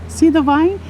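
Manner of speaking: usual speaking voice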